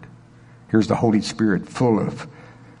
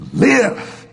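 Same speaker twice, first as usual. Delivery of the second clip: acted command; 11 semitones higher than usual. Third command to the corpse.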